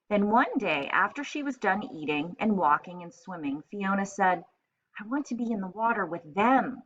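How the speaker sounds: storybook read-aloud for a class; lively picture-book narration; reading to children. Acted. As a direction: read it like story time on the rug. Teacher reads picture book aloud.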